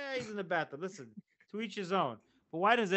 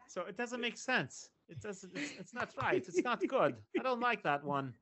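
normal talking voice

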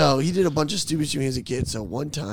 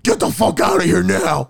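retold furious shout, much louder; losing it; 4 semitones higher, 9 dB louder than usual. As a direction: imitate a friend yelling into the mic. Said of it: "Keegan loses his mind".

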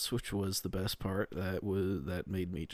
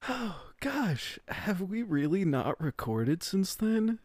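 surprised; 10 semitones higher, 4 dB louder than usual. Real, not acted. Realizes long time since recording.

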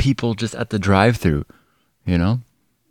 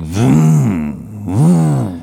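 car revving, done by mouth; imitated car engine. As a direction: rev like an engine, rising then falling. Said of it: "my car's like".

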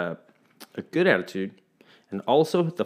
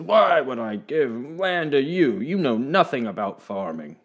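mocking bank man; 3 semitones higher than usual. A narrator's reading. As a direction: scoff at the boy from behind the desk. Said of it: "the big bank man burst out laughing".

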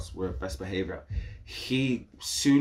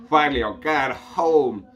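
louder, higher joyful cheer; excited; 5 semitones higher, 6 dB louder than usual. Retold or imitated. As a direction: burst out with relief. Excited to go home.

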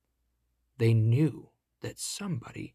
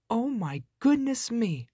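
put-on high, startled little girl's exclamation; high-pitched shocked cry; 11 semitones higher than usual. A narrator's reading. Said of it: "cried Goldilocks".

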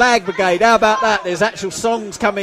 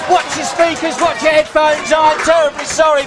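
high, loud warning to listeners; loud excited call; 8 semitones higher than usual. Real, not acted. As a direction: shout the warning with a grin. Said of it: "I'll apologise if you're wearing headphones".

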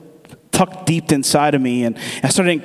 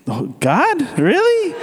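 high-pitched, incredulous "really?"; reluctant disbelief; 10 semitones higher, slower than usual. Retold or imitated. Man questioning God's nudge to take the Uber, reluctant disbelief. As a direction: question it as if pleading to be let off. Reluctant reply to the Holy Spirit.

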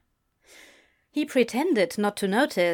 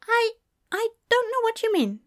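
high, stumbling denial; hesitant; 14 semitones higher than usual. A narrator's reading. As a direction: falter nervously in a high voice. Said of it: "he answered hesitantly".

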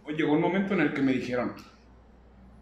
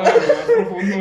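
high laughing squeal; 14 semitones higher, 9 dB louder than usual. Real, not acted. Joke, laughing into high pitch.

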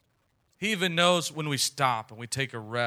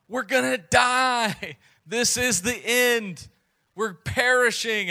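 high, slow, hammed-up panic of doomed sailors; panicked yell; 9 semitones higher, 6 dB louder, slower than usual. Disciples screaming to sleeping Jesus in the storm, mock panic. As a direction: wail it like men sure they will drown. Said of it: "the disciples are all yelling out like".

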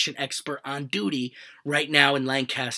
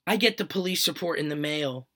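ordinary delivery

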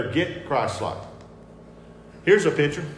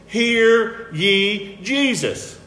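very slow, higher-pitched proclamation; voice of God; 7 semitones higher, 5 dB louder, much slower than usual. Retold or imitated. God speaks from heaven to the listeners, solemnly commanding. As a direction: stretch every word into a commanding decree. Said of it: "This is God from heaven".

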